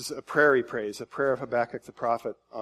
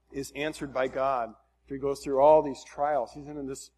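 ordinary delivery

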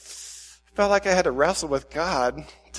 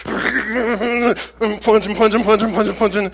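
louder, higher, strained imitation of frantic effort; violent plunging; 4 semitones higher, 3 dB louder, slower than usual. Preacher reenacting messy toilet plunging, violently. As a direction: act out furious plunging. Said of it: "I start the violent plunging".

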